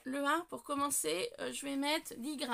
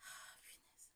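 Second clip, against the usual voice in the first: hushed whisper of frustration; whispered exclamation; 23 dB quieter, slower than usual. Real, not acted. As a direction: let it out in a whisper. Blade struggles with stuck cap.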